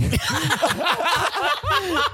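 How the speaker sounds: a high, burst of real laughter; big high laugh. Real, not acted. Laughing at his own line to mom, real high laughter. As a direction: crack up at the punchline.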